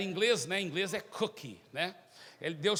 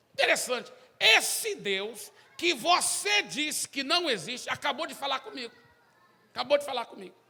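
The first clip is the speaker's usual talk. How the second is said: triumphant declaration; 7 semitones higher, 6 dB louder than usual. Acted. Zé declares God spoke.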